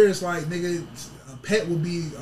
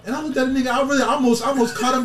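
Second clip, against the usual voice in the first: ordinary talk, nothing marked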